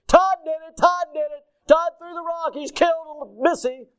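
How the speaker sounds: put-on high, loud panicked child yelling; a little brother yelling the news as he runs; tattling shout. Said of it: "he's saying these words".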